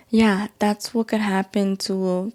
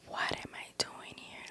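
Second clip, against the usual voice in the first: a stunned, barely audible whisper; whispered to herself; 20 dB quieter than usual. Retold or imitated. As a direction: breathe it out as a frightened inner thought. Inner panic on psych ward.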